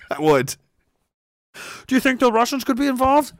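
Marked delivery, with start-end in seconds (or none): 1.9-3.3 high, quick mimicked question; mock presenter. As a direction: imitate a silly TV presenter's question.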